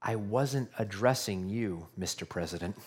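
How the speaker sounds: soft, low-pitched understatement; quiet reply. Retold or imitated. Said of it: "the press secretary quietly replied".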